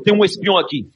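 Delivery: high, loud stern declaration; loud announcement. Acted. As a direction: announce it sharply to the room. Napoleon bursts in, announces.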